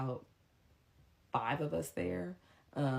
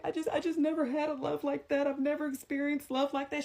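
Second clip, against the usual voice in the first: high whimpering plea; mock whiny lover; 12 semitones higher than usual. The man to her mom, high mocking whine. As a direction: pitch him up into a pathetic whine.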